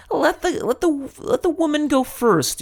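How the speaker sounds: emphatic. Real, not acted. Excited advice.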